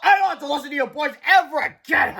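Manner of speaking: high, loud gleeful dismissal; triumphant principal; laughing gloat. A narrator's reading. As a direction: crow over the boys. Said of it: "laughed Mister Krupp".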